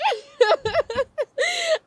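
a high, loud squealing laugh of joy; excited squeal laugh. Real, not acted. Overjoyed while preaching to her viewers, squealing laugh. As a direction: burst out squealing with delight. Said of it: "I got excited".

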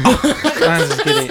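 high, slow laughing retraction; laughing take-back. Real, not acted. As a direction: giggle it out slowly. Joking after fake topic change.

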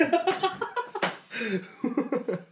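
long high laugh. Real, not acted. Teller finishes the embarrassing story, laughing hard. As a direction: crack up at your own yell. Laughing after the re-enacted yell.

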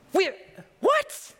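squeaky high wait, what; shocked. Pretend. Listener stunned by Jesus's words, high shocked squeak.

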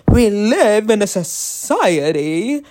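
hammed-up solemn declaration; mock grave announcement. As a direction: deliver it like a shocking revelation. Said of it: "this is gonna be a little bit shocking".

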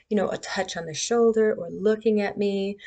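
ordinary talk

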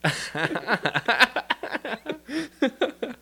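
long, high-pitched real laughing fit; high, uncontrolled giggling; helpless laughter. Real, not acted. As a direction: crack up and keep laughing. Cracking up at listener replies.

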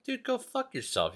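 high-pitched mock defiance; incredulous, high retort without extra volume; defiant comeback. Retold defiant comeback to threat.

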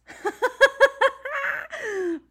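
a loud, high real laugh breaking out; high burst of laughter. Real, not acted. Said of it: "it's fucking hilarious".

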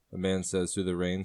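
ordinary talk, nothing marked

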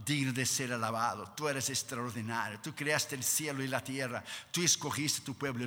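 low, soft, reverent adoration; acted hushed worship; Moses praising God. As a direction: praise gently and intimately, voice well down. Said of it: "Moisés llegaba delante de Dios y decía".

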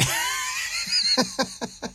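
high shrieking burst of laughter. Real, not acted. Laughing hard at his own Hollywood joke.

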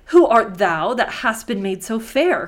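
loud, declaimed question; crying out. A narrator's reading. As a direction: cry it out in admiration. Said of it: "cried Narcissus".

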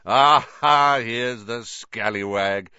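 loud, jovial "Aha", then drawn out; beaming welcome. A narrator's reading. Vain teacher greeting pupil at the door, beaming. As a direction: hail him with booming good cheer. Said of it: "Lockhart beamed down at him".

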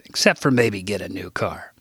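ordinary delivery

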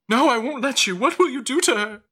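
high, loud panicked refusal; desperate mother. Acted. Mother refusing to hand over her baby, desperate. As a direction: protest frantically, pitch way up.

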